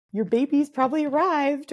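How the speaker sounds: lightly voiced marketing email; mock automated email. Quoting an automated baby email.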